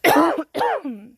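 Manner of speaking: sharp real cough from a swallowed bug; hard cough. Real, not acted. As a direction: cough hard to clear the throat. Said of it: "just swallowed a fly".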